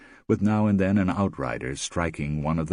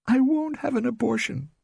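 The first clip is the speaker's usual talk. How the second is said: put-on high, tense young woman's voice; young wife refusing, strained; Rosemary, firm. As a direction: dig in, pleading and firm. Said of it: "Rosemary said".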